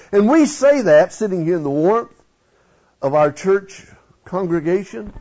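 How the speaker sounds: ordinary delivery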